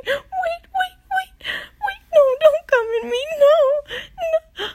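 high, urgent panic; rising, frantic protest; panicked pleading. Acted. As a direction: plead in alarm, pitch leaping up, ending loud and rushed.

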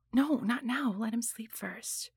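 soft, secretive plea to wait; hushed refusal. Acted. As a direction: whisper it so the house won't hear. Hushed aside, son nearby.

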